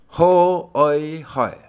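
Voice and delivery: 和爱海 said slowly, high and loud; demo of 和爱海. Shown as a demonstration. Teacher modelling 和爱海 slowly, high and loud for students. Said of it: "温和的意思".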